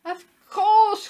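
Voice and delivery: very high, loud realisation; cried out. A narrator's reading. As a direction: cry it out as it finally clicks. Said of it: "cried Willie Woof".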